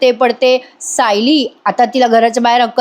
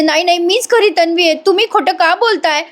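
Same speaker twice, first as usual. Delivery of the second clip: higher-pitched mock indignant denial; acted protest; 6 semitones higher than usual. Priya insisting she is the real one, protesting. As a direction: protest like someone wrongly accused.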